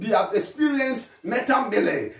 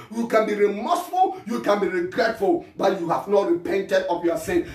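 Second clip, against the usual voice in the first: ordinary talk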